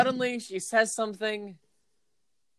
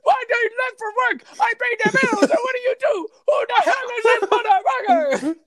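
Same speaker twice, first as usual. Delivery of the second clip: high, loud, shouting Muppet rage; jealous chef yelling at his girlfriend for cheating; furious Swedish chef; 5 dB louder than usual. Acted. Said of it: "He is furious".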